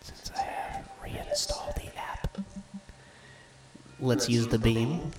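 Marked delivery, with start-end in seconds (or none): 0.0-2.4 whispering. Real, not acted. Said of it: "And I have to whisper".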